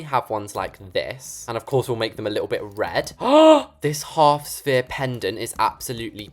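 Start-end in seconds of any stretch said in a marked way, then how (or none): 3.2-3.8 loud, high gasp; delighted gasp. Real, not acted. Spots a perfect pendant light.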